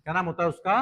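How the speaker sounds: usual speaking voice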